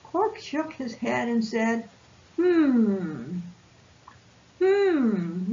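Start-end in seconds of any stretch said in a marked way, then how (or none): 2.4-5.5 thoughtful hum. Acted. Thinking hum repeated.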